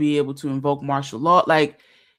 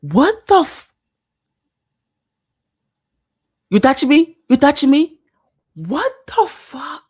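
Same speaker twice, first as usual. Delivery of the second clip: shocked outburst; 11 semitones higher, 9 dB louder than usual. Real, not acted. Reacting to shocking clip.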